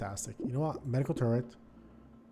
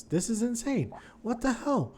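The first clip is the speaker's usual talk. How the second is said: higher-pitched, incredulous exasperation; exasperated outburst; 9 semitones higher, 3 dB quieter than usual. Real, not acted. Gamer to viewers after dying, high exasperated cry. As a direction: squeal at the game in disbelief. Player just lost the run.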